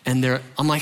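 ordinary talk

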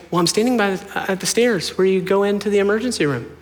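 lightly put-on voice of mom explaining; imitated mom.

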